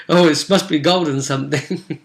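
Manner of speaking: ordinary talk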